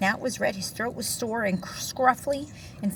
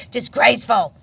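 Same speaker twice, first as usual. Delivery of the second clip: loud indignant bark; 3 semitones higher, 11 dB louder than usual. Acted. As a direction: spit the word out in loud outrage. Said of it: "barked Bear".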